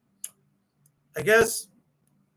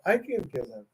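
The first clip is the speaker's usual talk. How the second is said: softer repeat; 6 semitones lower, 7 dB quieter, much faster than usual. Real, not acted.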